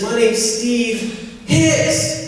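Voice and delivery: put-on teen stuttering his name nervously to a bank president. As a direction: stammer out your name to a stranger. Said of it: "my friend starts to stutter a little bit".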